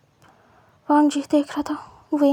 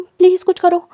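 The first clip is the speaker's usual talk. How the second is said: higher and louder, pleading; loud begging; 4 semitones higher, 7 dB louder than usual. Acted. Wang begs Wing to do something, loud plea. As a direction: plead loudly for help.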